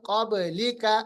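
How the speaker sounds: क़ब्लिका with a bounce after ब; qalqalah demo. Shown as a demonstration. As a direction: echo the ब in क़ब्लिका. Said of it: "क़ब नहीं". (क़ब्लिका में बा का क़लक़ला)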